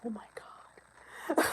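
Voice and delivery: slow, breathy whispered groan; exasperated whisper. Retold or imitated. Overwhelmed by the noisy Zoom meeting, slow whispered groan. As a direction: whisper it like your head is pounding. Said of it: "I was, like, going".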